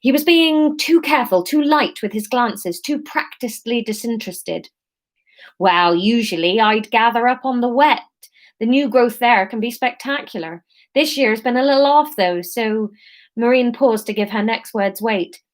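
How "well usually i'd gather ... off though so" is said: put-on Somerset accent. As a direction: keep the Somerset burr going. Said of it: "this is gonna be Somerset".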